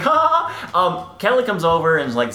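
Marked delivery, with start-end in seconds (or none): 0.0-0.9 mock laugh. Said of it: "And she's like".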